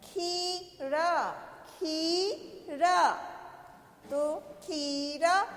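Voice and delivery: खीरा very slowly, holding the ee; drawn-out long vowel. Shown as a demonstration. Teacher modelling खीरा for children, syllables drawn out. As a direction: stretch खी, then add रा. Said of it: "देर तक बोल रहे हैं".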